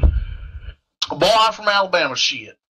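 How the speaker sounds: hammy Alabama pride; southern accent. Pretend. Soldier tells squad where he's from, Southern accent. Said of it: "do you have a southern accent?".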